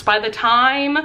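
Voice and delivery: ordinary talk, nothing marked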